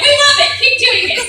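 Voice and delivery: loud, high, laughing cheer; retold enthusiasm. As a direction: imitate thrilled colleagues egging you on. Retold delighted reaction of colleagues.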